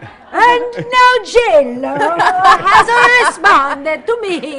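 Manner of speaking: loud, drawn-out, hammed-up Italian accent; accidental Italian accent. Real, not acted. Host bragging that a star retweeted her, Italian accent. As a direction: announce it high and slow, slipping into mock Italian. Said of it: "I'm talking with an Italian accent".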